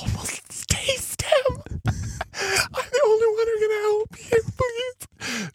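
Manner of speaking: high, slow, whimpering ghostly plea; creepy pleading from beyond; eerie possessed voice. Pretend. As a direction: beg like a haunted spirit. Playing the possessed exorcist.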